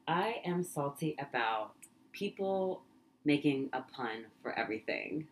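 usual speaking voice

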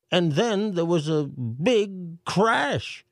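high mayor voice, slow on the crash; mayor reading, slowing dramatically. A narrator's reading. Mayor reads the crash to a frightened crowd, slow and dramatic. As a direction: draw out the big crash.

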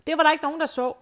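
a high, loud retold shout; shouting across. Tipsy lady teasing the smoker across the room, shouting. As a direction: call it out across the restaurant to tease him. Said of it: "hun råber sådan til ham".

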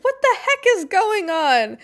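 high, flustered exclamation; mock-panicked doctor asking what's happening; worked-up doctor. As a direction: play a small frazzled doctor in a crisis. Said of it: "worked-up doctor going".

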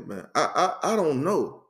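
usual speaking voice

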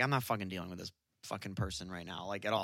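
fast, low, under-the-breath grumble of refusal; fed-up inner voice. Retold or imitated. To himself about the pushy customer, fast fed-up grumble. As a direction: mutter it to yourself, done with this person. Annoyed inner thought at merch table.

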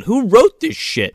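high, slow incredulous rant; exasperated outburst. Retold or imitated. Podcaster fed up with the script, exasperated outburst. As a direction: draw it out in exasperation. Ranting about the girl's dialogue.

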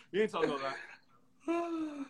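high and slow through laughter; cracking up mid-sentence; laughing. Real, not acted. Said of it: "This boy is a fool".

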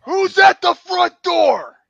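high, slow, loud holler; calling out. Acted. Wrestler interrupted by a visitor, hollering across the house. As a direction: bellow it toward the front door.

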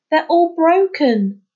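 high, loud and slow, near tears; upset cry. A narrator's reading. Finn mourning his shells, upset and slow. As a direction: wail it over the broken shells. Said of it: "he blurted out".

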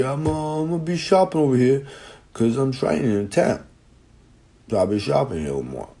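mock the big guy's high chatty voice; imitating football player. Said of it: "At least I fucking thought it was".

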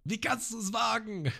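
empörter Ausruf. Retold or imitated. Dorfbewohner empört über Nami.

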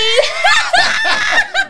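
high shrieking burst of laughter. Real, not acted. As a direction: let out a squealing laugh. Laughing after joke. (carcajada chillona)

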